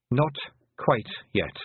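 ordinary delivery

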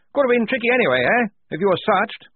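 high, loud hearty officer voice; blustering major. Acted. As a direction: boom out like a jolly old officer. Major winking.

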